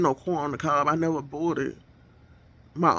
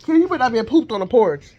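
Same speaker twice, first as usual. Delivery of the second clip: higher, louder warning to the cat; scolding a pet; 5 semitones higher, 6 dB louder than usual. Real, not acted. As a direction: scold the cat, half joking.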